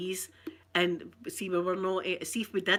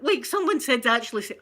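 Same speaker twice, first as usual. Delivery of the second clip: ordinary delivery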